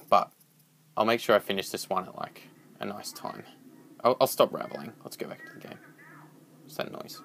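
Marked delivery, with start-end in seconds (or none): 5.0-7.2 hushed whisper, barely voiced. Real, not acted. Streamer slipping back into the spooky game, whispering. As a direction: lean in and whisper as the game turns creepy.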